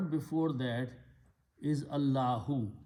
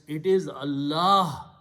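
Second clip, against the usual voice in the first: slow, loud stress on "Allah"; emphatic stress on Allah; 8 dB louder than usual. Shown as a demonstration. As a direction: lean hard on "Allah", drawing it out. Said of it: "You have to emphasize".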